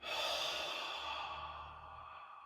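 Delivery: long breathy exhale through the mouth; strong exhale sigh. Shown as a demonstration. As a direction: let all the air out in one sigh. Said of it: "Nice strong exhale".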